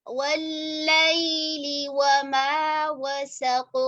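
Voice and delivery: वमा वसक़ drawn out slowly; slow model recitation. Shown as a demonstration. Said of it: "teacher models recitation". (धीमी तिलावत)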